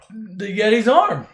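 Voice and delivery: usual speaking voice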